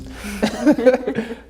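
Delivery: high, bursting laugh; big laugh. Real, not acted. Laughing at his friends after teasing them.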